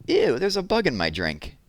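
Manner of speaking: put-on a six-year-old grossed out by her drink; little girl voice. Little girl at the stand, disgusted. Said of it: "a little girl, about six years old, said".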